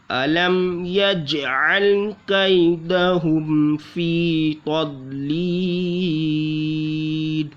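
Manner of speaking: slow, drawn-out tajweed recitation; slow melodic Quran recitation; Quranic recitation. Acted. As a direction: chant the verse melodically. Recites the verse.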